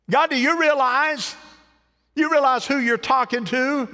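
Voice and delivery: high, loud incredulous cry to God; preacher shouting up at heaven; pleading shout. Acted. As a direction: cry out in disbelief at God's call. Preacher voicing plea to God.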